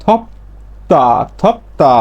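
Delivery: থপতা slowly split, hard t after the p; drawn-out model of থপতা with a t sound; changed consonant. Shown as a demonstration. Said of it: "তাহলে এর উচ্চারণ হবে কি?". (থপতা, ধীরে ভেঙে ত দিয়ে উচ্চারণ)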